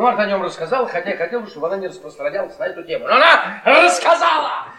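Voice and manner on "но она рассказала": indignant outburst. Acted. Marta'nın ifşasına öfkeyle haykırış.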